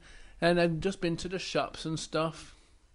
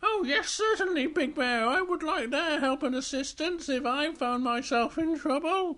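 Little Bear voice; 10 semitones higher than usual. Acted.

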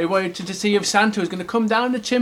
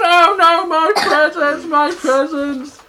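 high, loud wail; drawn-out storybook lament; mock dismay; 11 semitones higher, 7 dB louder, slower than usual. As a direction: wail in play horror at the loss. Presents burn, bear cries out.

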